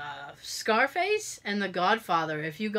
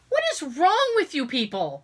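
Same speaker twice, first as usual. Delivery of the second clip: exasperated disbelief; 6 semitones higher than usual. Retold or imitated. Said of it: "And I'm like".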